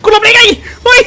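high frantic yells while dodging; panicked yelling. Real, not acted. Dodging attack, panicked gamer. (ตะโกนตื่นตระหนกเสียงสูง)

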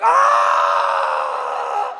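long, very loud mock scream; inner scream. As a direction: let out a drawn-out scream of inward horror. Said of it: "on the inside, I was like".

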